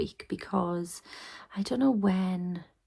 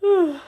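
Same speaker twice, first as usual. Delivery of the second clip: loud sigh; 11 semitones higher, 11 dB louder than usual. Real, not acted. Tired sigh late at night.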